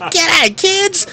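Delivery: loud, high, hammed-up Aussie uncle greeting; bogan uncle. Bogan uncle bursting in on the kids, hammed-up loud greeting. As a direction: burst in like a larrikin uncle yelling hello. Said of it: "the bogan uncle to rock up".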